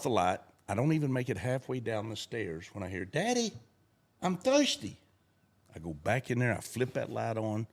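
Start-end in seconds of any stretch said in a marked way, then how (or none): 3.0-5.0 put-on high child's whine; child calling for his daddy; little boy calling. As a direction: call down the stairs like a small kid. Child voiced in story.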